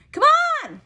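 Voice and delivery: put-on high, loud eager child's shout; eager call. Girl hurrying daddy to school, high eager call. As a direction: pull daddy along with the voice. Trixie urging daddy along.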